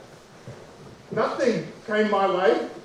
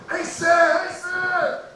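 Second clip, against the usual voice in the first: put-on kids cheering; 8 semitones higher, much slower than usual. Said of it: "he gets a hero's welcome".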